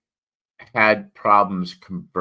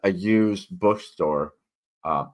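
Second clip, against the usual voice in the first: usual speaking voice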